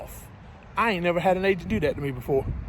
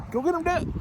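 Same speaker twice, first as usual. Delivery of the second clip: high cooing voice to the dog; pet talk; 9 semitones higher than usual. Real, not acted. Trucker cheering on his dog Dad, high pet-talk voice.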